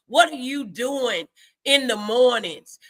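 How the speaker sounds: ordinary talk, nothing marked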